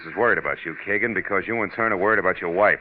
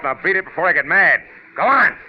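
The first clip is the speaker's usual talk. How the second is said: rising to a loud, higher bark; angry order; 10 semitones higher, 7 dB louder than usual. Acted. Marlowe orders the amateur gunman Kagan home, loud and angry. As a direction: lose patience and drive him off.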